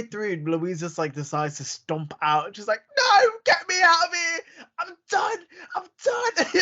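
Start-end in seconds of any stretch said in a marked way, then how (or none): 3.0-6.5 high-pitched tearful shriek imitating a walk-out; hammed-up hysterical outburst, much higher; mock screaming. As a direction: wail it in an over-the-top tantrum. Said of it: "she's just in the car park screaming".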